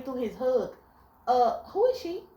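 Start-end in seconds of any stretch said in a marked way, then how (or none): 1.3-2.2 high, overly polite question; fake-polite who is she; sweetly suspicious. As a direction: ask nicely while fuming inside. Politely asking mid-hug.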